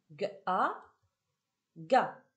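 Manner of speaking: hard "gue", then "a", then blended crisply into "Ga"; teacher blending "G-A" into "Ga"; sounding out syllables. Shown as a demonstration. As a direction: say the two sounds apart, then join them as "Ga". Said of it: "on va passer à la combinatoire".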